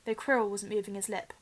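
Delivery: usual speaking voice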